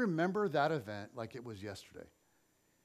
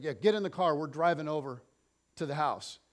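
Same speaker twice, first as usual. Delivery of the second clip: loud, insistent command; firm mom order; 7 dB louder than usual. Retold or imitated. Mom telling her son they are driving to the house, loud and insistent. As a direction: lay down the law, no debate. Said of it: "She's like".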